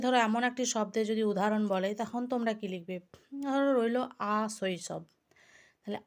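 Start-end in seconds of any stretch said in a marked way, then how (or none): none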